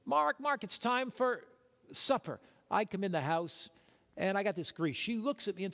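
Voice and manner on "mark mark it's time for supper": wife calling out. Retold or imitated. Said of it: "my wife calls me".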